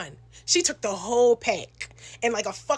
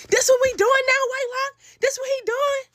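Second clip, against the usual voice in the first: much higher, louder accusing cry; high outraged confrontation; 12 semitones higher, 4 dB louder than usual. Retold or imitated. Lady confronting the boyfriend on video call, shrill high outrage. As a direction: shriek it at the cheating man on camera.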